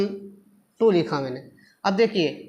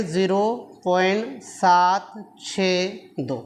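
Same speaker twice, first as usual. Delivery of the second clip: "सात छः दो" read slowly as separate digits; slow, spaced-out reading of "सात छः दो"; much slower than usual. Shown as a demonstration. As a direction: draw out "सात", "छः", "दो" one at a time. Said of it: "इसलिए इसको क्या पढ़ेंगे".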